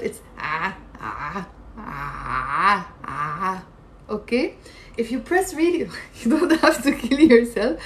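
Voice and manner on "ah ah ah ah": low, strangled "ah" pressed in the throat; as if choking, squeeze out "ah"; choked ain. Shown as a demonstration. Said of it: "If you're choking yourself".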